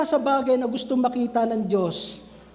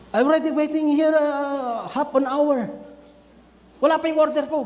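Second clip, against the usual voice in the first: raised, higher mock complaint to a waiter; angry complaint; 4 semitones higher than usual. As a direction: play the impatient customer scolding the waiter. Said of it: "Galit na galit ka na". (galit na reklamo sa waiter, pakunwari)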